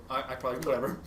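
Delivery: ordinary talk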